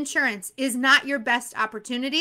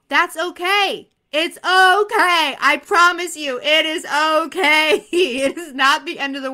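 reassuring; 2 semitones higher, 6 dB louder, slower than usual. Real, not acted. Reassuring failing students.

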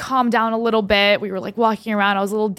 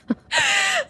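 squealing laugh. Real, not acted. Laughs after the quote.

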